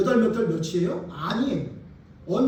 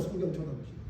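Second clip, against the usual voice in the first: much softer, lower echo; soft repeat; 8 semitones lower, 12 dB quieter, faster than usual. Shown as a demonstration. Pastor urging congregation, soft low echo. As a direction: let it sink in quietly. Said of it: "repeated take".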